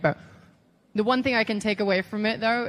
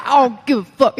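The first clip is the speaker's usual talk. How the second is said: loud, brash cussing impression; Dusty Rhodes; 3 semitones higher, 8 dB louder than usual. Trainer constantly cussing, loud hammed-up impression. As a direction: blurt it like a foul-mouthed trainer. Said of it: "this is a Dusty impression".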